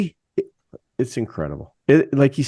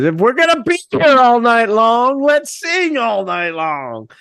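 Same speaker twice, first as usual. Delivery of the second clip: quoting the singer; 10 semitones higher, 4 dB louder than usual. Retold or imitated. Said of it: "he basically said".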